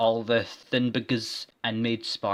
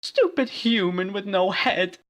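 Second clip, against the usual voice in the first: high put-on gloating voice; smiling villain; 10 semitones higher, 5 dB louder than usual. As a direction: gloat with a smile. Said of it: "she said with smiles".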